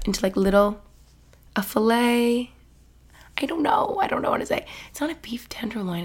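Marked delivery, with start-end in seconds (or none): 3.4-6.0 trailing off into a whisper; hushed, embarrassed not-knowing; unsure whisper. Real, not acted. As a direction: mutter it to yourself, unsure. Unsure aside about the meat.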